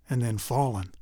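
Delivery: plain reading voice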